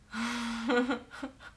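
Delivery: high-pitched giggle; real laugh. Real, not acted. Streamer amused by chat's reaction, real high giggle. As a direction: burst out giggling. Reading chat after her impression.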